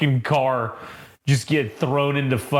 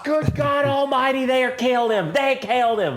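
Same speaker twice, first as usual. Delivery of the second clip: high, drawling wrestling-commentator outcry; Jim Ross; 10 semitones higher than usual. Acted. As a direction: call it like an appalled ringside announcer. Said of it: "it's, it's Jim Ross".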